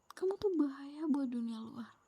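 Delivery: ordinary delivery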